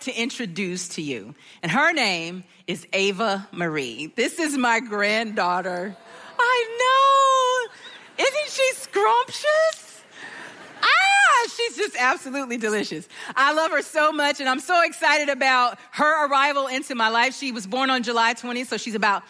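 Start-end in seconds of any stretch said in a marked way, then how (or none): 6.4-13.1 high, drawn-out squeal of adoration; real delight, voice shooting way up; gushing grandma. Real, not acted. Gushing over granddaughter's photo.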